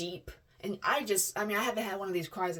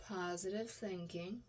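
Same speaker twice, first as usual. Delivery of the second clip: quiet mantra; 9 dB quieter than usual. Real, not acted.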